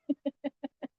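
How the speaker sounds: soft laugh. Real, not acted. Laughs after telling prayer.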